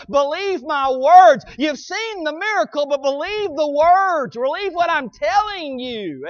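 fervent preaching. Real, not acted. Preacher urging the church to trust the Word, fervent preaching. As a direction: preach with insistence, stretching the closing phrases.

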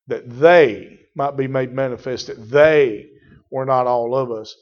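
ordinary talk

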